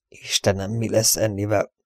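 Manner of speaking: soft, fearful murmur; horrified murmur. A narrator's reading. Steve fearing for Annie, softly horrified. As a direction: breathe it out softly in dread. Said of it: "suttogta megborzadva".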